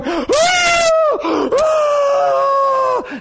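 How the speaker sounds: long high held scream. Retold or imitated. Visiting husband breaking down in his wife's arms, screaming. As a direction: let out a wail of remorse. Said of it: "this man starts screaming".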